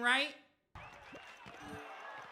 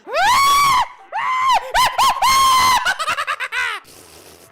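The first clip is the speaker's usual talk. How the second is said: high, very loud wailing shriek; long frustrated scream; 15 dB louder than usual. Real, not acted. Gamer raging at the game, long scream. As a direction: scream it out at full force.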